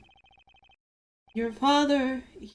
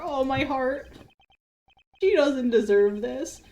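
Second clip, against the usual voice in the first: ordinary talk, nothing marked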